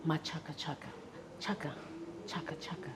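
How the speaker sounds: name repeated, trailing off to a whisper; fading to a whisper. Retold or imitated. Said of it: "he says".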